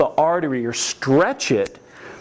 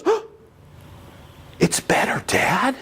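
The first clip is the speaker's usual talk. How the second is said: mock gasp, then hushed amazement; breathless, whispered wonder, acted out; imitated amazed child; 5 semitones higher than usual. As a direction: gasp and play a suddenly relieved kid. Acts child whose knee pops back.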